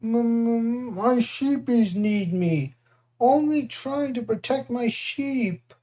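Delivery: high, slow, tearful monster stammer; pitiful stammering giant. Acted. Defeated Cyclops pleads for his sheep, slow tearful stammer. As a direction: plead about the sheep near tears. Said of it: "He began to sob".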